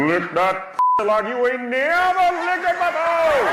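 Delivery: high-pitched mock rally speech; civil rights activist. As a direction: deliver it like a hammed-up protest orator, pitched high. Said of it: "She sounded like a civil rights activist".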